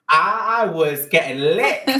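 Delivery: high, loud, drawn-out boast; gleeful confession. Real, not acted. Speaker owning up about the fake sleepovers, gleefully.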